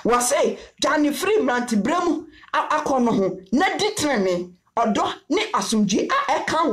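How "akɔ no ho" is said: normal talking voice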